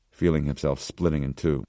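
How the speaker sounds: ordinary delivery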